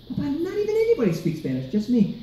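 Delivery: put-on high-pitched, hurried kid's complaint; complaining child. Schoolkid back home telling dad, high hurried complaint. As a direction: pitch it way up and rush it.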